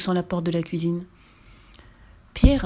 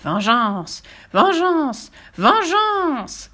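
rising shouted cry, each higher and louder; 7 semitones higher, 4 dB louder, much slower than usual. Acted. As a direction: howl for vengeance, climbing. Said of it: "elle s'écria".

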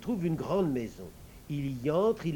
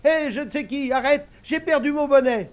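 much higher, louder alarmed call; calling out in flight; 11 semitones higher, 11 dB louder than usual. Acted. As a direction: shout over the wind to stop. Idiot calls out in flight.

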